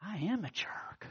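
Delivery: slow, soft whisper of self-blame; whispered realization. Retold or imitated. The husband whispering to himself in sudden remorse. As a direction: breathe it out as a shameful realization. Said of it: "all of a sudden he thinks".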